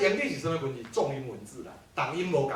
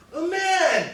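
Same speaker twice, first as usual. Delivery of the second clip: 夸张高扬的语调; 12 semitones higher, 5 dB louder than usual. Shown as a demonstration. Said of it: "第一个讲".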